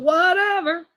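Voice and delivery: high, drawn-out sing-out of dismissal; dismissive. Real, not acted. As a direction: toss it off loftily, stretching it out. Brushing off the rival wife.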